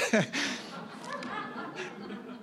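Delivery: airy laugh up high, no voice; high breathless laugh. Real, not acted. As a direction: let a delighted breathy laugh escape. Laughing after the story.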